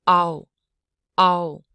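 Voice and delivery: loud "ow", a and u blended fast; quick vowel blend. Shown as a demonstration. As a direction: run a straight into u for "ow". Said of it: "a real quick blend of a and u".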